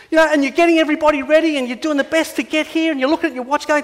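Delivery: ordinary talk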